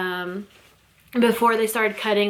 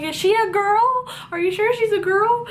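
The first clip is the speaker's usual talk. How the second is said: surprised disbelief; 12 semitones higher, 3 dB louder than usual. Retold or imitated. New mom asking the doctors about her newborn, much higher and disbelieving. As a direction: ask as if you can't believe it.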